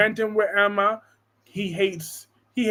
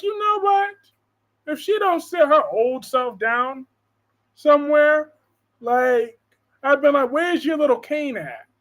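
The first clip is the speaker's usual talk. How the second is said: higher, mock-sassy comeback; sassy retort; 7 semitones higher than usual. Answering Gloria back in a sassy mock retort. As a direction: tease the old lady. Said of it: "I'm like".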